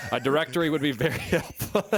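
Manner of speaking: breaking into laughter while reading aloud; real giggles bursting through the words; laughing. Real, not acted. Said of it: "I'm not laughing at you".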